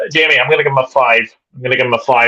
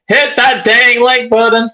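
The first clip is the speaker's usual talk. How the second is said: hammed-up American accent, pitched higher; bad American accent; 8 semitones higher than usual. Host reading a viewer's comment aloud in a mock American accent. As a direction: read the chat line in a mock American accent. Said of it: "Was that your best American accent".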